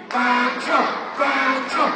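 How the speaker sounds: loud, high call of the name; big crowd-leading shout. Pretend. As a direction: holler to wake a sleeping puppy. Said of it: "start calling his name".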